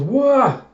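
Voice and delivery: high, loud "wah" of someone slipping; startled yell. Acted. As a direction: yelp as your feet go out from under you. Character slips down the hallway.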